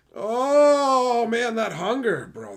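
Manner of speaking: high, loud wail on a long "oh"; hungry outburst. Real, not acted. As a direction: holler it out as hunger builds. Loud outburst about growing hunger.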